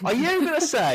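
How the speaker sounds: high, put-on Cockney challenge; mock Cockney devil's advocate, pitched high; Cockney accent. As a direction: argue it like a cheeky Cockney. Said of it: "this person's got an accent".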